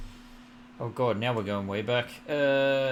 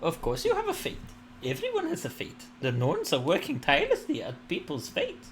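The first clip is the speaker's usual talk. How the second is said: much higher, lilting goddess; warm serene goddess voice; 7 semitones higher than usual. Acted. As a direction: speak as an all-knowing deity. Goddess speech, high voice.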